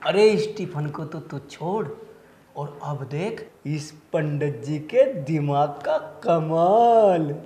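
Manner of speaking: slow, drawn-out relish; scheming boast. Acted. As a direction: stretch each word out slowly and low. Sly boast about his clever plan.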